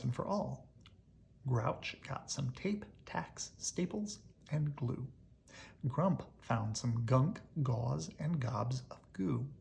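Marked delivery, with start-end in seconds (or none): none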